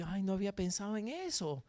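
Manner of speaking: soft, low imitation of a listener; meek mock reply. Preacher imitating the listener's sudden realisation, meek and hushed.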